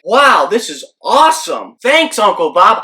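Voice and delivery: thrilled, punched-up retold reaction; freaking out. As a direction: gush thanks as if freaking out. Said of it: "I'm freaking out".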